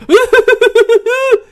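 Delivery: loud, very high-pitched mock squeal; shrill, hammed-up staccato yelp-giggle; Benji's comic squeal. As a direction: imitate a comic sidekick squealing hysterically. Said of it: "Benji skal sidde og sige".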